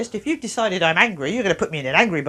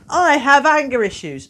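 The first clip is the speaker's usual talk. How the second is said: higher, louder little voice saying I have anxiety; timid child's label read, higher and louder; mock child's label voice; 6 semitones higher, 6 dB louder than usual. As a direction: play the labelled kid, up and loud.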